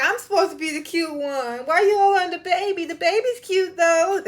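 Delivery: high, put-on little girl voice; voicing a jealous little girl. Vlogger voices Brooklyn jealous of the baby, high childish voice. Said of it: "She's like".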